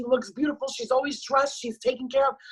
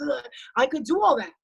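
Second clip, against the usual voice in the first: ordinary delivery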